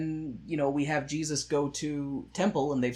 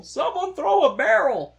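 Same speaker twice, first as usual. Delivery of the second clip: much higher, louder mock yell; mock shout; 13 semitones higher, 9 dB louder than usual. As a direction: yell it out like a game cue. Shouting a Donkey Kong joke.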